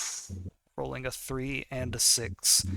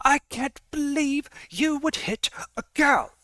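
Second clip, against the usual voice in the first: put-on very high, loud squeaky girl voice; hammed-up high-pitched indignation; indignant fangirl; 6 dB louder than usual. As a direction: play an outraged fangirl in falsetto. Acted indignant fangirl after attacking.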